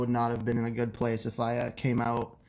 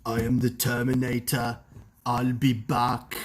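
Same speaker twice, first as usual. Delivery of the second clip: Arnold impression. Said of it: "anyone can do an Arnold impression".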